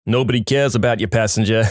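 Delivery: ordinary delivery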